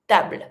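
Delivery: "Table" with B and L run together, no vowel between; French "table" with the B and L joined. Shown as a demonstration. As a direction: say "Table" the French way, a short tight ending. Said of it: "You combine the B and the L".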